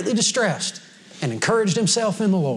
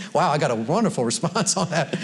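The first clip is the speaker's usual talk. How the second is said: normal talking voice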